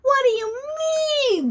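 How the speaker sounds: high, loud gasped question; gasping. Acted. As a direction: gasp in shock. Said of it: "the bunny said with a gasp".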